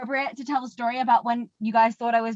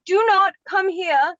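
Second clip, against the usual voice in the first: slow, louder, higher mock shout; retold yell; 5 semitones higher, 5 dB louder, slower than usual. Repeating the warning to people who didn't understand, emphatic mock yell. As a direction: spell out the warning, loud and drawn out. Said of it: "And yelled it".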